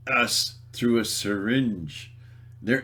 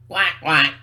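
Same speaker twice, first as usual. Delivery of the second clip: loud, higher duck impression; two punchy duck calls; duck quack; 5 semitones higher, 7 dB louder than usual. Imitates duck in riddle.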